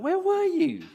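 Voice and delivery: high, loud call to Thomas. Acted. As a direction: call out to someone who isn't there. Preacher calls out to absent Thomas.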